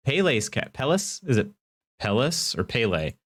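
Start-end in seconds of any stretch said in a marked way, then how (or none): 2.0-2.6 Pelis said as PELL-iss; alternative pronunciation. Shown as a demonstration. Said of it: "I wanna say Pele, but I don't know". 2.6-3.1 Pele said as PAY-lay; preferred pronunciation. Shown as a demonstration. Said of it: "I wanna say Pele".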